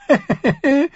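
high cackling giggle; shrill gleeful laugh. A narrator's reading. Lysa laughs at Sansa's coming widowhood, high giggle. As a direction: laugh with cruel delight.

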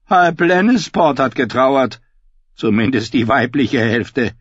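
high, loud bleating sheep voice. Acted. Davin voiced by narrator.

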